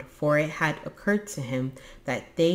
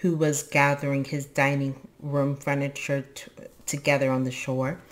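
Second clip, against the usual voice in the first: plain reading voice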